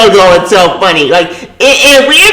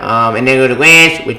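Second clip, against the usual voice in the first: normal talking voice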